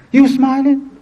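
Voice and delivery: high-pitched disbelieving challenge; retold incredulous question. Family confronts the smiling daughter, incredulous. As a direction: act out disbelief at her reaction.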